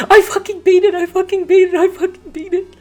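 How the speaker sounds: excited, fading. Real, not acted. Repeats the win three times.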